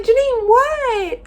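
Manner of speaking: friends shouting her name, high and loud, slowly; alarmed friends. Retold or imitated. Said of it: "my friends were like".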